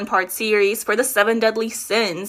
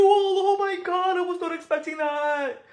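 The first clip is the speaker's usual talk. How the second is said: surprised; 8 semitones higher than usual. Real, not acted. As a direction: genuinely caught off guard. Shocked by the reveal.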